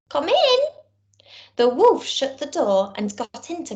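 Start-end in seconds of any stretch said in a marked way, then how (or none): 0.1-0.6 high, louder granny call; grandma's voice. Acted. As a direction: sing out a high old-lady welcome. Grandma invites wolf in.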